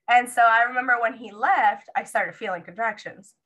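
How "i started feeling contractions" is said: ordinary talk, nothing marked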